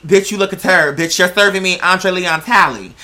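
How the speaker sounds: normal talking voice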